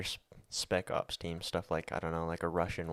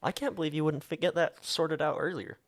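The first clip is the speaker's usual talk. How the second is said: incredulous; 8 semitones higher, 3 dB louder than usual. Real, not acted. Incredulous about ignoring symptoms.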